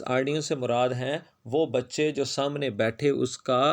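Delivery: usual speaking voice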